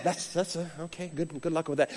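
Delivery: soft, low muttered reply; doubtful aside. Retold or imitated. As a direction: imitate an unconvinced leader under the breath. Leader's doubtful reply, acted.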